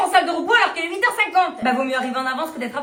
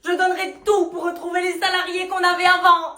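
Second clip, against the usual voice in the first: normal talking voice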